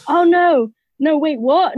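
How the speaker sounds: high-pitched, comic dismay; mock-alarmed, pitched well up; retold alarm. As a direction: replay your startled double-take. Acts out shock at booking mistake.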